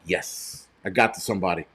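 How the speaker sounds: breathy, quietly gloating aside; hushed self-satisfied gloat; smug whisper. Retold or imitated. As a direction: whisper it like a small private victory. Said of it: "I'm like".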